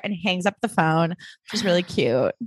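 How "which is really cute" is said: normal talking voice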